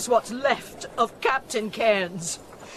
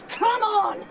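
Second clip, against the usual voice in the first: higher, forceful shout; straining shout; 7 semitones higher than usual. Acted. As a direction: push through with everything. Forcing her way through.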